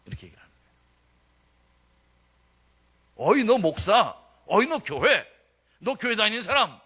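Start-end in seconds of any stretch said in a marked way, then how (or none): 3.1-6.8 slow, loud jeering call across the mess; mocking loud taunt. Colonel taunting the new chaplain at lunch, loud slow jeer. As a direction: bellow it to humiliate him.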